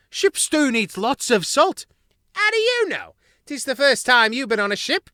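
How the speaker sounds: rowdy galley crew trading insults; squabbling crew mice. Acted. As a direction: cooks bickering over the pot. Crew bicker over cooking stew.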